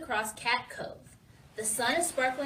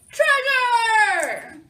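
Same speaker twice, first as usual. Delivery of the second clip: loud, high, drawn-out cheer; crew's cry; 9 semitones higher, 10 dB louder than usual. A narrator's reading. Pirate crew off to find treasure, excited shout. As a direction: holler it out long and excitedly. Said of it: "cries the crew".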